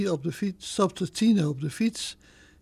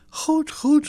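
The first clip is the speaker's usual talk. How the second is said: high, louder concession; hasty agreement; 10 semitones higher, 5 dB louder than usual. A narrator's reading. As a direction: give in hurriedly. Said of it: "haastte Tine zich snel te zeggen".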